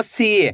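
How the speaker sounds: "सी" held long, falling at the end; drawn-out long ee. Shown as a demonstration. Teacher gives learners an example, stretched "सी". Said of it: "खींच के पढ़ोगे".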